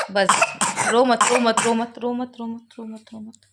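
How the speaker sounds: calm him down gently, fading away. Real, not acted. Mother soothing crying sick child.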